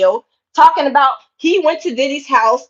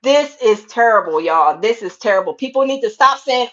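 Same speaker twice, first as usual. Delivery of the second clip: ordinary talk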